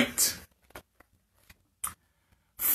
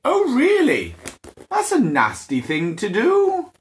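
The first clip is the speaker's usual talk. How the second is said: high, indignant oh really; a dragon poked by Vikings, indignant and high; affronted dragon; 9 semitones higher than usual. Acted. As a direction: sound pricked and offended.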